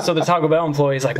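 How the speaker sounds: ordinary delivery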